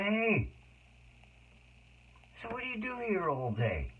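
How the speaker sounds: high, bright cheerful greeting; chirpy hello to a lonely patient; upbeat visitor voice; real upbeat. Retold or imitated. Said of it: "So I was real upbeat".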